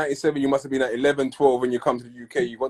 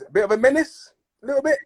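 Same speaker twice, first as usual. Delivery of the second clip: rising, teasing question that climbs much higher and breaks into a laugh; playful teasing; 12 semitones higher than usual. Real, not acted. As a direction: tease him gently about being a menace. Teasing the guest about his past.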